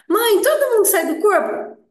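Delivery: high, loud, childlike mock voice; mock naive question. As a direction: play the gullible follower asking mom. Mocking a naive follower's question.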